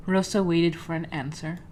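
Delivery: ordinary talk, nothing marked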